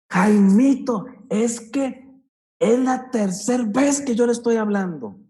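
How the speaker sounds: high, loud scolding of Jaimito; exasperated parent raising the voice. Shown as a demonstration. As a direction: yell at the kid who won't listen. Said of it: "subiendo el tono".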